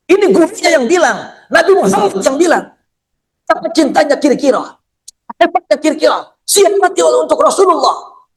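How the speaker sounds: high-pitched, incredulous sermon; fervent preacher's oratory; impassioned preaching. Real, not acted. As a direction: drive the point home to the congregation, pitched high.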